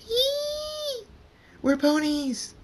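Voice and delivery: very high, loud squeal of delight; high-pitched joyful shriek; excited girlish scream. Acted. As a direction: shriek it like thrilled little girls. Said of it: "Julie and Kate scream".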